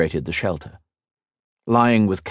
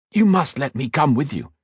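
raised, eager and pleading; eager plea; 7 semitones higher than usual. Acted. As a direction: beg to come along, excitedly. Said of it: "leaned across the table excitedly".

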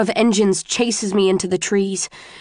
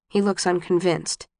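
plain reading voice

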